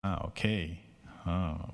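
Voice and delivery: ordinary delivery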